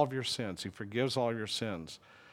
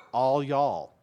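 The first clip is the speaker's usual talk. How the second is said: All y'all said in a loud Southern drawl; 2 semitones higher, 7 dB louder than usual. Retold or imitated. Preacher quoting a friend, loud Southern drawl. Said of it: "this is the southern version".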